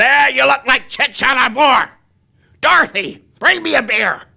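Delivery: high, crusty character voice; father-in-law impression. Father-in-law demanding beer from Dorothy, high character voice. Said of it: "That was my father-in-law".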